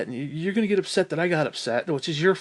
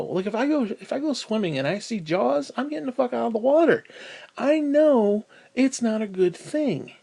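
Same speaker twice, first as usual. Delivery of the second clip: normal talking voice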